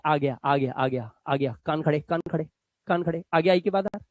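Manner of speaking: low, teasing ears-up chant; mock warning to prick up your ears; playful alert. Shown as a demonstration. Teacher playfully alerting students to the R. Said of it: "कान खड़े हो जाने चाहिए".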